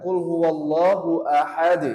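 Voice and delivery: slow, loud Qul huwallahu ahad with the d bounced; slow recitation with qalqalah. Shown as a demonstration. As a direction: recite slowly and pop the final d.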